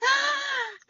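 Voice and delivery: sharp high gasp of surprise; excited high gasp; big gasp. Retold or imitated. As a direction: gasp like you just saw the news. Seeing the thousandth subscriber message.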